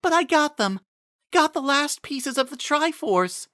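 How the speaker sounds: put-on a young noblewoman shrugging off pain; weary Miranda. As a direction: Miranda reassuring Impa after her fall, weary. Miranda voiced in story.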